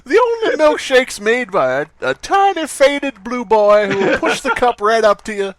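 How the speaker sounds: mock commercial voice. Spoof commercial for the tiny blue boy's milkshakes, hammed-up announcer. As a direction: oversell it like a late-night ad.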